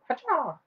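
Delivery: high, sharp martial arts yell; mock karate shout as the hand chops; karate chop cry. Shown as a demonstration. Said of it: "like, karate chop".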